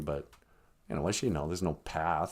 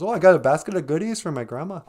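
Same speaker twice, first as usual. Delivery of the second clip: put-on higher, quick little girl voice; quick, raised-pitch innocent reply; lightly voiced Little Red; 7 semitones higher, 4 dB louder, much faster than usual. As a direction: chirp it like a fairy-tale girl, lightly.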